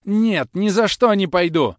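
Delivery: high, loud, slow tearful refusal; cling and refuse through tears; desperate refusal. Acted. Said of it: "цепляясь за его сюртук".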